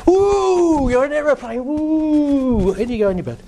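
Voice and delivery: put-on high, bouncy dad voice winding the kid up; dad getting the kid all excited at bedtime, playful and high; excitable dad. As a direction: play the dad hyping up the child at bedtime. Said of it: "Dad comes in, goes".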